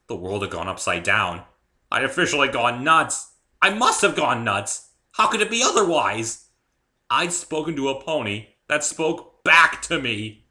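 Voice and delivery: panicked disbelief. A narrator's reading. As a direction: spiral into panic. Narrator losing his mind.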